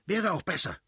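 high, hurried, exasperated retort; aggrieved wife's outburst; interrupting. A narrator's reading. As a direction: cut in and argue back quickly. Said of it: "unterbrach die Fürstin".

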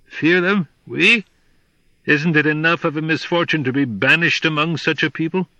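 high, loud outraged jackal; indignant disbelief. Acted.

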